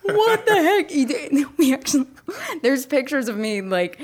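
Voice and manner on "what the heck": very high-pitched disbelief; high, stunned re-enactment; exceptionally surprised. Retold or imitated. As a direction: squeal it as if caught totally off guard. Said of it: "You were exceptionally surprised".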